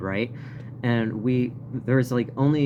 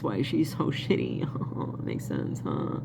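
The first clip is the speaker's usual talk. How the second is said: mocking whispered aside; a sarcastic hushed jab at the movie; 8 semitones lower, 7 dB quieter than usual. Real, not acted. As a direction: whisper it with heavy irony. Sarcastic aside about Cruella movie.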